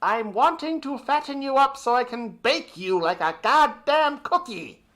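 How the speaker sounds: a hint of a creepy witch; mock witch voice. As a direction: sneer it like a smug villain. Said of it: "someone who said".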